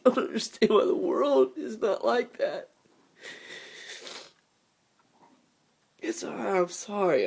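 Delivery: high, breaking voice, apologising through tears; trying to compose himself while weeping; crying. Real, not acted. Apologising to viewers for crying. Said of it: "I'm sorry about the crying".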